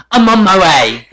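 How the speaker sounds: loud, high, dramatic British-tinged "I'm on my way"; mock Imani impression. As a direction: belt it like a reality star arriving. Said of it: "she slams her laptop and she goes".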